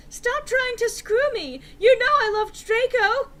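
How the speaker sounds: very high, loud furious shout. Acted. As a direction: scream it at him in anger. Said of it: "I shouted".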